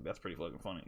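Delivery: mid-yell, suddenly hushed and amused; amused murmur. Retold or imitated. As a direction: mutter it, amused. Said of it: "I'm like".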